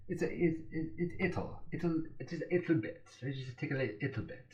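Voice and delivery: a high, half-hearted attempt at a British accent; bad British accent. Shown as a demonstration. Study vlogger apologizing for his poor British accent, mock British accent. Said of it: "apologize for my British accent, it's not very good".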